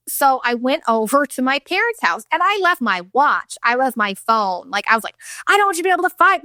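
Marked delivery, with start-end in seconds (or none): 5.5-6.4 frantic retell. Retold or imitated. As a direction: rush it out like a manic moment. Manic episode retold.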